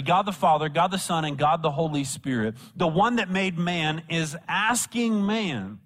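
usual speaking voice

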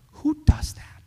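whispered, incredulous question; awed whisper. Real, not acted. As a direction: breathe it out in hushed amazement. Awed question after crucifixion retelling.

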